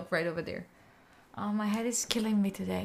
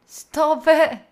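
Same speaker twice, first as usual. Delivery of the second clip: sharp shout; 6 semitones higher, 10 dB louder, much slower than usual. Real, not acted. Telling chat or the game to stop.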